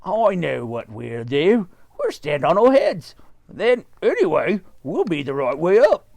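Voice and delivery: husband proposing to stand on heads; eager Mister Twit. Acted. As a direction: explain the plan eagerly. Mister Twit explains plan.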